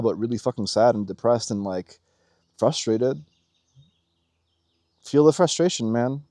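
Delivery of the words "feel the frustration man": usual speaking voice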